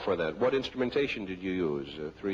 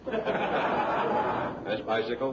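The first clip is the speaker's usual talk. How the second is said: laughing; 2 semitones higher, much slower than usual. Real, not acted. Host laughing through words.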